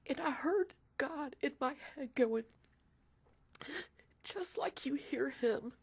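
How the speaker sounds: tearful, through crying. Real, not acted. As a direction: voice cracking, real tears. Retelling son's illness.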